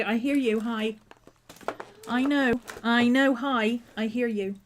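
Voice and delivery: usual speaking voice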